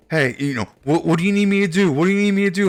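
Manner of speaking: high, loud mocking voice of the helper; mock pushy helper. Mocking the helper who won't let go, loud and high. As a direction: squeak it out like an overeager busybody.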